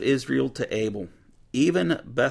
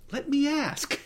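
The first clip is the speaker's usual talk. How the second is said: high, perky offer; volunteer brightly; eager woman; 14 semitones higher, slower than usual. Retold or imitated.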